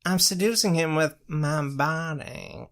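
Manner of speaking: put-on slow, high creepy old-man voice; Tree Trunks impression. Shown as a demonstration. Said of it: "I gotta go with Tree Trunks".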